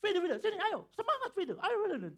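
loud, very high, urgent calls; high-intonation teacher. Acted. Preacher mimics the teacher urging a pupil on, high and forceful. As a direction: imitate a forceful Eastern Indonesian teacher rallying a pupil. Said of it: "ini intonasinya tinggi".

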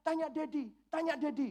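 a hint of a whiny seven-year-old; little daughter. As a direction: lightly play the sulking little girl. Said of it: "ditanyain sama orang-orang di rumah".

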